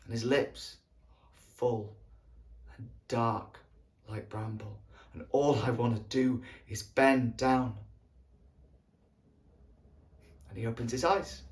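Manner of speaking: low, soft, halting reminiscence; tender longing. Acted. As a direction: linger softly over each detail, with pauses. Recalling longing for sleeping friend.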